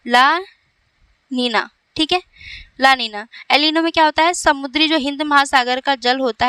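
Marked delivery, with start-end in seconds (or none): 0.1-1.7 very slow, La and Nina separated; drawn-out term. Shown as a demonstration. Teacher giving students the new term, slow and broken up. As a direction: say ला, pause long, then Neena.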